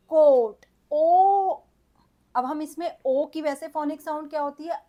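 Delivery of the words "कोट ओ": emphatic demo word. Shown as a demonstration. Teacher to pupils, calling out कोट and ओ loudly. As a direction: boom the vowel ओ for the class. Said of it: "C ओ A T".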